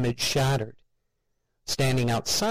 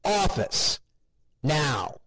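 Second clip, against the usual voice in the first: higher, barked command to the office; angry order; 6 semitones higher, much slower than usual. A narrator's reading. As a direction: order him in, furious. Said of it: "face red with anger".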